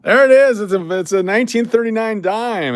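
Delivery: high, loud excited reveal. Real, not acted. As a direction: burst out with the reveal.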